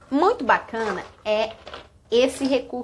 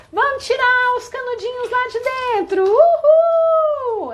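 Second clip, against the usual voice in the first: high excited whoop; delighted high call to toddlers; playful cheer; 12 semitones higher, 5 dB louder than usual. Shown as a demonstration.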